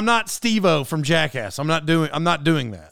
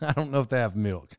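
said through a laugh, softer; 6 semitones lower, 7 dB quieter than usual. Real, not acted. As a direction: chuckle the words out. Laughs repeating friend's words.

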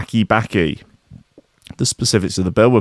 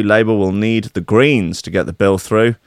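usual speaking voice

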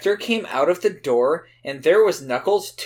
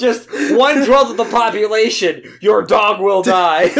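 laughing; 6 semitones higher, 7 dB louder than usual. Acted. Joking mock horoscope.

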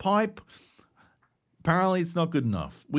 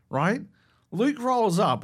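normal talking voice

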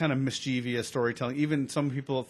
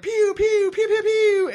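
very high loud laser noises; pew pew sounds; 9 dB louder, slower than usual. Retold or imitated. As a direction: fire off squeaky pew pews. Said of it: "He's like".

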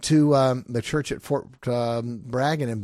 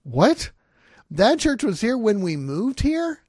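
much higher, louder, slow incredulous question; can't believe what mom said; stunned retold "What?"; disbelief; 11 semitones higher, 6 dB louder, slower than usual.

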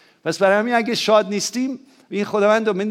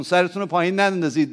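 ordinary talk

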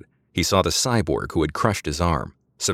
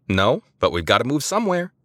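Eliza voice; 7 semitones higher than usual. A narrator's reading. Eliza answers, voiced higher.

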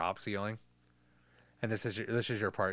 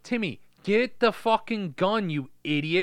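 loud, higher frustrated yell; shouting; 9 semitones higher, 6 dB louder than usual. Acted. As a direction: yell at him impatiently. Yelling at Timmy.

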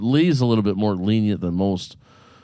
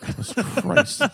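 exasperated; 13 semitones higher than usual. Real, not acted. Exasperated reaction to friends.

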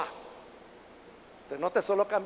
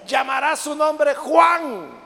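very slow, raised declaration; emphatic proclamation; 3 semitones higher, 4 dB louder, much slower than usual. Acted. Angel naming Zacarías's son, proclaimed loud and slow. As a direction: draw out the naming like a herald.

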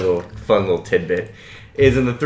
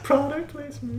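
high, soft sing-song; little high-pitched teasing call; joking aside; 10 dB quieter than usual. Pretend. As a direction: chime it in softly, way up high. Joking aside about Coke in film.

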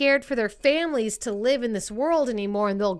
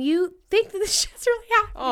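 high, quick, laughing disbelief; real laugh breaking into the words; laughing while talking; 9 semitones higher, faster than usual. Real, not acted. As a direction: crack up mid-sentence. Cracking up while telling it.

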